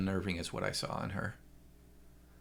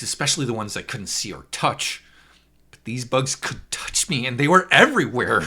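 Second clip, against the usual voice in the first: higher and louder, rising panic; frantic, as if the bugs are still on you; panicked telling; 7 semitones higher, 10 dB louder than usual. A narrator's reading. Narrator reliving the phantom bugs on his skin, panicked and loud.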